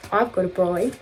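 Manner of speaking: mock Australian accent; lightly imitated Australian twang. Repeating the brolly catchphrase, Australian accent. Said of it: "in an Australian accent".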